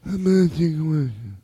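Godfather voice. Acted. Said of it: "Godfather impersonations".

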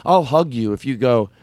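usual speaking voice

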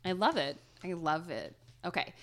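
ordinary talk

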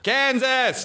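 high, hollered mock American call; playful high-pitched holler; mock shout. Shown as a demonstration. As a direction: shout it as if across vast empty plains. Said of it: "you have to shout".

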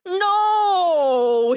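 high, drawn-out wail; anguished cry. A narrator's reading. Lion failing to pull out the thorn, crying out. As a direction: cry out in anguish, stretching the word. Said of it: "he cried".

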